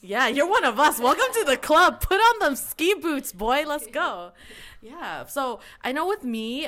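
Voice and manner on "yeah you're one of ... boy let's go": loud, high, laughing hype-man welcome; over-the-top jubilant welcome; mock club welcome. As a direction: holler them into the club, playfully hammed up. Acts out welcoming someone into the club.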